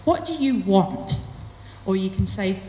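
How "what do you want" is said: "want" drawn out with a falling tune; slow question landing on "want"; stress on want. Shown as a demonstration. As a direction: lean slowly on the last word, "want". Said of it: "put stress or emphasis on a different word".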